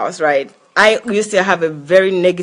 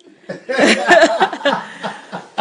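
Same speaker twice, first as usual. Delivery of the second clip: burst of laughter; 5 semitones higher than usual. Real, not acted. Overwhelmed by his talk of death.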